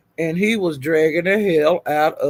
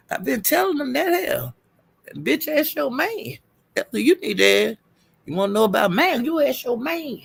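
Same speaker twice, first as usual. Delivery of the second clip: sassy giggling; 6 semitones higher, 5 dB quieter than usual. Real, not acted. Sassy laughing retort.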